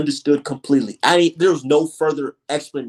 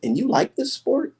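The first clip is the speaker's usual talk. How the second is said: higher, incredulous quote; mock their disbelief about boxing; imitating baffled people; 8 semitones higher than usual. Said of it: "they always look at me like".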